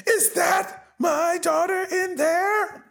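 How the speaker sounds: high, strained anguished plea; Sean Penn's anguished cry. Retold or imitated. Father at the police tape, cries out for his daughter, anguished. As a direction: wail it like a father at the police line.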